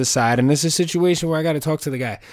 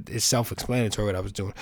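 normal talking voice

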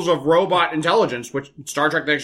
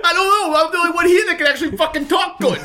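much higher silly voice with a laugh; mock dumb voice; 12 semitones higher, 3 dB louder than usual. Friend mocks Arnold and Sly talking, silly high voice. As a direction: ham up a confused meathead.

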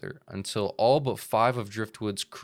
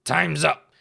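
loud, higher pirate bellow; pirate shout; 4 semitones higher, 5 dB louder than usual. Acted. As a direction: roar it like a pirate. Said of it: "he shouted".

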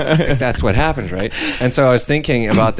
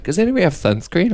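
calling out; 10 semitones higher than usual. Retold or imitated. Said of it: "somebody's like".

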